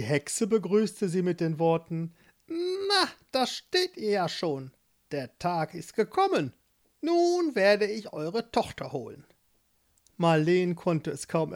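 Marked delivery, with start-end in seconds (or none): 2.5-9.2 put-on high, sly old witch. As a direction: play the witch, curious and pleased. Witch voiced in story.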